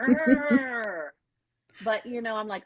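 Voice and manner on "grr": mock frustrated growl; a short cartoon growl; playful growl. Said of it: "and you're like".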